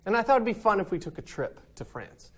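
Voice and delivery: ordinary talk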